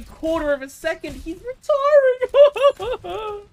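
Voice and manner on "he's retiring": mock lament. Mocking enemy character.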